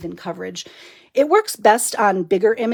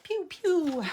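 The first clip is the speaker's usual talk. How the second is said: higher, playful mouth-made laser pew pew; 10 semitones higher, 5 dB quieter than usual. Pretend. Crafter adding sound effects for viewers, higher playful pews. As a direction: toss off a silly zap sound effect. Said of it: "Gotta do sound effects too".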